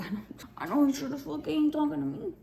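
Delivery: half-laughing loud comeback; loud retort. Retold or imitated. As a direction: fire it back loud and amused.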